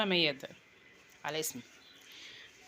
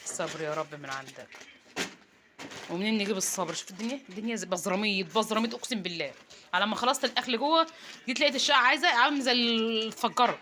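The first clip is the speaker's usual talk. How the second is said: ordinary talk, nothing marked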